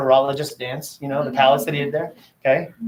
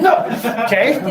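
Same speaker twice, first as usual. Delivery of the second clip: high laughing "No, okay"; 8 semitones higher, much slower than usual. Retold or imitated. Parent laughing at daughter's mispronunciation, high and laughing. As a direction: laugh it off while correcting her. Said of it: "I'm like".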